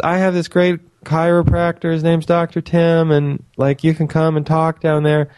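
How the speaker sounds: high, eager friend voice; imitating Nicole. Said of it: "she says".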